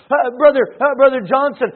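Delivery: normal talking voice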